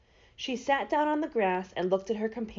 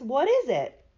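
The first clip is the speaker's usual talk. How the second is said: higher, louder surprised question; raised, curious exclamation; 5 semitones higher, 5 dB louder than usual. A narrator's reading. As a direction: ask with a burst of surprise. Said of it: "answered Dorothy in surprise".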